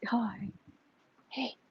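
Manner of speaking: soft whispered hello to a pet; barely voiced hi; whispering. Real, not acted. Quietly greeting the cat.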